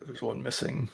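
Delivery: softly spoken secret aside; quiet half-whisper; hushed aside. Acted. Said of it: "I'll whisper to James real quick".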